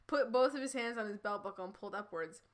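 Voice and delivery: ordinary talk, nothing marked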